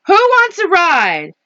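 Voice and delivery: high, swooping call to friends; storybook truck calling all aboard; cheery invitation. A narrator's reading. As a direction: invite everyone with excitement.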